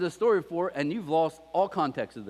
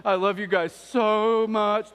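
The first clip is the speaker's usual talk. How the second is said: slow, hammed-up tearful kid apology; mock sobbing apology; 3 semitones higher, 4 dB louder, much slower than usual. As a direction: overdo a child's teary sorry, dragging every word. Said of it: "They're crying".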